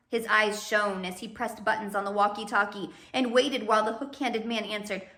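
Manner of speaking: usual speaking voice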